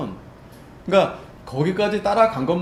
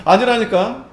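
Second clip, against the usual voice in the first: higher, louder impatient denial; irritated insisting; 7 semitones higher, 8 dB louder, slower than usual. Retold or imitated. Peter's second denial to the servant, impatient. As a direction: shut the question down. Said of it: "두 번째".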